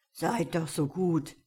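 put-on raspy old woman asking a favour; hoarse, coaxing disguised wolf; rough-voiced old woman. A narrator's reading. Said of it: "so hatte sie eine ganz raue Stimme".

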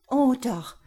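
loud, high outcry; exclaimed. Acted. Laura pulling free of Jerome's grip, loud exclamation. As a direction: burst out, tearing herself loose. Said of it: "rief sie aus".

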